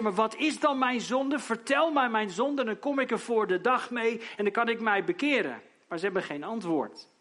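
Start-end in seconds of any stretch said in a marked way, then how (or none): 0.0-5.6 raised, pleading demand; Job pleading loudly. Acted. Job pleads with his accusing friends, loud and high. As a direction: demand to know the sin, voice lifted high. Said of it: "Job die zegt".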